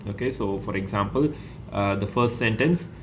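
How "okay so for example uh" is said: normal talking voice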